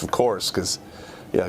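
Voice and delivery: ordinary talk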